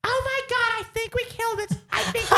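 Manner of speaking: very high cartoon squeal; screaming [name]. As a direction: shriek it in mock panic. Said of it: "but she's screaming".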